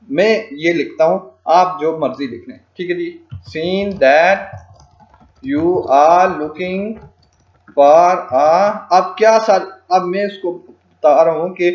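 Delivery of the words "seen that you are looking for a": drawn out word by word, with pauses; slow dictation. Shown as a demonstration. Tutor typing out a client message for learners, slow dictation. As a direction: say it slowly as you type it.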